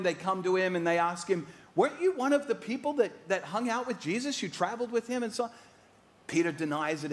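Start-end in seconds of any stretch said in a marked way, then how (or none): 1.8-5.5 much higher and quick, prying; accusing bystander. Retold or imitated. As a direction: needle him with the question. Accusers question Peter a second time.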